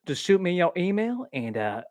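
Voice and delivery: exaggerated celebrity accent for a parody ad; Matthew McConaughey. Vlogger spoofing the Art of Living commercial, drawling accent. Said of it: "I made fun of Matthew McConaughey's".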